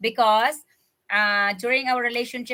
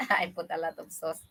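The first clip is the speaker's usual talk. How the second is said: softer, laughing through it; laughing softly; 6 semitones lower, 8 dB quieter than usual. Real, not acted. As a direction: chuckle the words out quietly. Repeats sauce remark laughing.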